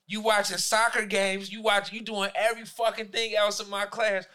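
ordinary talk, nothing marked

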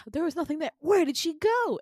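high, loud baffled outcry; mock bewilderment. Guards finding the patient gone, loud mock bewilderment. Guards discover she has escaped.

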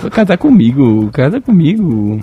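swooping put-on singer's voice. Joker proposing as the imitated singer, swooping. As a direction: plead with swooping pitch, mimicking. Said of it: "mania de falar igual o [name]".

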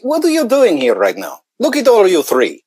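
vague Russian-ish accent, pitched well up, mock comic; stand-up comic voice. Guest as stand-up teasing the three hosts, in a hammy vague Russian accent. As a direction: ham it up like a stand-up doing a foreign accent. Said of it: "like a stand-up co".

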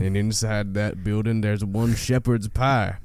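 very slow, low cowboy drawl; cowboy accent. Acted. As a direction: stretch every word like a movie cowboy. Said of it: "Cowboy accent can be cool".

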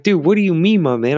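high, loud incredulous challenge; mock-outraged, voice shooting up; retold exasperation. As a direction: act out indignant disbelief. Acted quarrel with the drunk guy.

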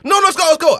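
high, insistent imitation of a girl; pushy dancer. Girls pushing him to go dance, high insistent voice. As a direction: pitch it way up and press. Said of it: "they was real pushy there".

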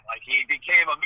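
ordinary delivery

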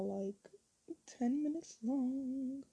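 normal talking voice

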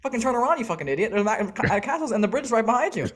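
high-pitched, hammed-up tirade; exasperated mock rant. Yelling directions at lost drivers like Dora, exasperated. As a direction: badger them in comic outrage, voice climbing toward the end.

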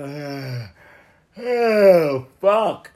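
loud, high yawn into a curse; waking-up yawn groan. Retold or imitated. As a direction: stretch a big yawn into fuck. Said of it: "you yawned and said".

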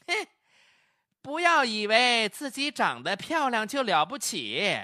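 high-pitched, disdainful put-down; haughty, unhurried. A narrator's reading. Customer lording it over the humbled clerk, haughty. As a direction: look down on her and take your time. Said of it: "端起上帝的架子了，不紧不慢地说了".

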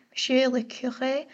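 careful French accent on the title; attempted French pronunciation. Shown as a demonstration. Host trying her French again on a priest's title, French accent. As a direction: try it in French, guttural R in Curé. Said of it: "let me try my French again".

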